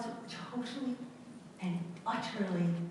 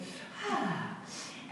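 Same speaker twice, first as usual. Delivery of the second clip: soft falling breath out, higher; rhythmic breath, exhaled softly; breath demo; 11 semitones higher, 5 dB quieter than usual. Shown as a demonstration. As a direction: let the breath fall away. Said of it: "sounded like this".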